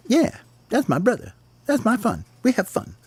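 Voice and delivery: very high, soft chirpy voice; proud little sparrow. Acted. Smalls proudly tells the flock about brother, high and soft. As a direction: brag gently about your brother in a tiny voice.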